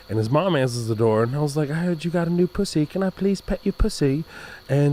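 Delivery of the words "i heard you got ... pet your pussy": put-on innocent kid. Innocent kid asking neighbor.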